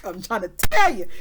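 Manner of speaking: loud, playful outburst; big, hammed-up exclamation; emphatic insistence. Real, not acted. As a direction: belt it out to drive the point home. Hammed-up insistence about being dragged.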